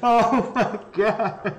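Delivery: high and slow, laughing. Real, not acted. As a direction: barely get it out for laughing. Laughing at the clip.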